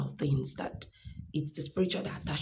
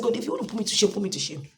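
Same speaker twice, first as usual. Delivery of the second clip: loud, high challenge to God; fierce faith-filled declaration; defiant prayer; 5 semitones higher, 7 dB louder than usual. Retold or imitated. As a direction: call out to God in bold faith. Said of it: "I said".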